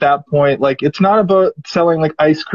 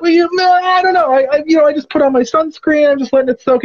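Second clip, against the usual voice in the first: high, fumbling mock voice of a nervous swimmer; flustered beachgoer; 10 semitones higher than usual. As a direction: stammer out excuses in a squeaky put-on voice. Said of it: "the guy's, like, clearly like".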